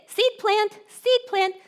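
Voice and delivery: high, chirpy mock call; cartoonish little singsong; playful singsong. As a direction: toss it out lightly, way up high. Mimes scattering seeds in passing.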